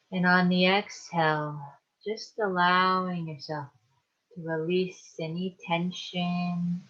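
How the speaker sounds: calm, unhurried meditation guide with long pauses; guided meditation. Acted. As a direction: ease them into relaxation, leaving space to breathe. Leads a guided relaxation meditation.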